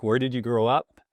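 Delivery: ordinary talk, nothing marked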